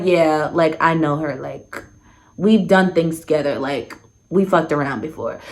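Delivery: low, soft, matter-of-fact retelling; imitating blatant admission. Woman admitting past hookups to storyteller, blatantly matter-of-fact. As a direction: own up casually, as if it's nothing. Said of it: "she said it blatantly out loud".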